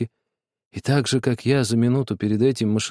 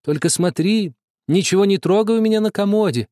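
put-on sister calling out sharply after the boy; 7 semitones higher, 3 dB louder than usual. A narrator's reading. Sister calls after the boy, sharp and raised. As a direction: call a warning down the hall. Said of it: "она крикнула мне вслед".

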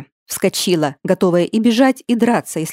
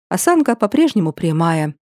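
ordinary delivery, nothing marked

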